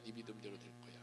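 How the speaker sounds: quiet closing echo. Shown as a demonstration. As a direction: drop to a quiet assurance on 네 믿음대로. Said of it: "네가 그렇게 믿어?".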